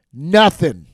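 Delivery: loud, punched second nothing; shouted repeat. Acted. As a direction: bark the word in frustration.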